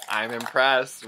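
ordinary talk, nothing marked

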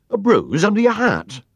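high, loud startled question; concerned echo. A narrator's reading. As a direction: repeat it back in alarmed disbelief. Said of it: "repeated Alf Duckham, looking most concerned".

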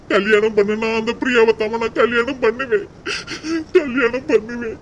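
usual speaking voice

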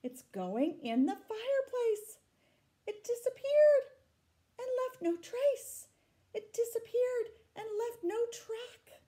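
put-on reading the vanishing beast to children, high and loud; excited climax. A narrator's reading. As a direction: cry out that it vanished.

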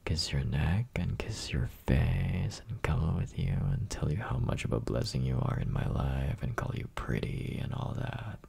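put-on breathy close-mic whisper to a girlfriend; teasing boyfriend whisper, close and intimate. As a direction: whisper it right into her ear. Whispered list of affections.

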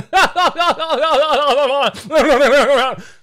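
high, loud laugh rolling into babble; maniacal mock laugh. Nintendo ending the skit, hammed-up rolling cackle. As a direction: let the fake cackle run away.

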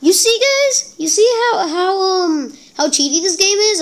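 high, tearful whining complaint; whiny upset. Real, not acted. Gamer to viewers after losing, blaming the game: high tearful whine. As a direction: plead with viewers that it's unfair.

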